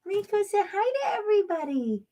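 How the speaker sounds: high sing-song voice for a dog; pet talk. Real, not acted. Owner coaxes dog to say hi, pet talk. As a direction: coo high to your dog.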